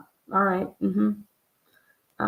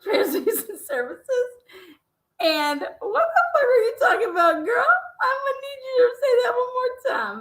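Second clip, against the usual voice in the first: high, teary laughing; laughing to tears; 9 semitones higher than usual. Real, not acted. Host crying with laughter at the guest. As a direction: laugh until you cry.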